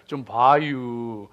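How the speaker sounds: slow mock Chungcheong accent; Chungcheong dialect. Shown as a demonstration. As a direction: stretch it out lazily like a Chungcheong local. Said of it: "충청도 사람은요".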